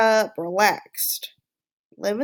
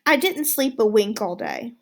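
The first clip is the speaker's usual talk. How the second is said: plain reading voice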